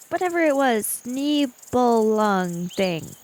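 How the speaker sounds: ordinary talk, nothing marked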